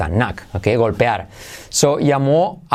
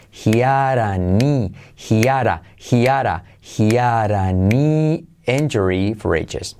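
slow, stretched phrase, built up piece by piece; slow teacher's model; repeat-after-me drill. Shown as a demonstration. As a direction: draw the vowels out for learners. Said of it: "Repite conmigo".

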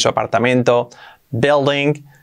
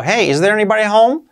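calling out; 9 semitones higher than usual. Shown as a demonstration. Calling into a house.

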